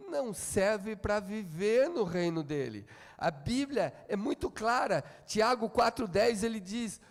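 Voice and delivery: ordinary talk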